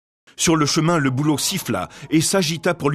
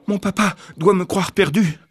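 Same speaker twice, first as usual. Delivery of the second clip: put-on higher little girl voice; girl's worried thought; 7 semitones higher than usual. A narrator's reading. Girl running home, worrying her father thinks her lost. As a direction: think it anxiously.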